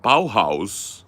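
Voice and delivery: BAUHAUS loud and drawn, both halves like "bow-house"; attempted German pronunciation. Shown as a demonstration. Speaker answers how it's supposed to be said, attempted German pronunciation. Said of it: "How's it supposed to say?".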